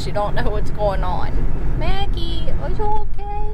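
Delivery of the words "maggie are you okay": high sing-song pet voice; talking to a pet. Real, not acted. Cooing to the dog.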